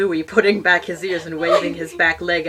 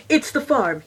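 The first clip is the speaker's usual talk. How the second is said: excited cry; 8 semitones higher, 4 dB louder than usual. Acted. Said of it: "he cried".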